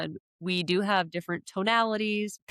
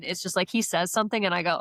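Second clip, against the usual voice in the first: ordinary talk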